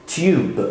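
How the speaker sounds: TUBE as "tyoob"; British tube. Shown as a demonstration. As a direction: put a Y after the T in tube. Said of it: "في البريتش إنجليش".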